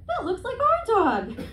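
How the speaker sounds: high, childlike mock little girl. Little girl in the Petco, high and childlike. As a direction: play the little girl pointing at the dog, high.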